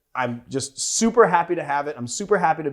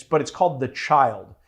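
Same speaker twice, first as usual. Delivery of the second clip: ordinary delivery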